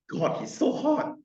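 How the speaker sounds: high breathy gasp, swooning over him; swooning girl. Pretend. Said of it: "the girl is like".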